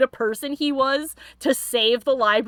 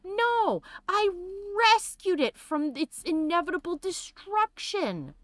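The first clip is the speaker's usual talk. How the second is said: mock self-justifying nobleman; 6 semitones higher than usual. Streamer mocking the book thief's excuse, hammed high self-justifying voice. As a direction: imitate him pompously excusing himself. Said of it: "He's like".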